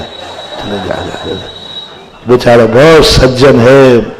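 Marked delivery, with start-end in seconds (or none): 0.0-1.3 soft, low shoo-away; a lightly voiced brush-off; dismissive shooing. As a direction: wave him off quietly, much lower. Dismissing someone.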